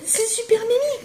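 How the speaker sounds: high-pitched delight over something cute. Real, not acted. Showing a tiny ladybird stamp, high delighted voice. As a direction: squeal it as adorable.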